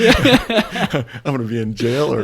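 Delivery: loud, high laughter through the words; laughing. Real, not acted. Host joking about jail to his cohost, laughing.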